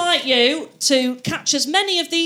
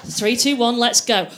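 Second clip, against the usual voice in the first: normal talking voice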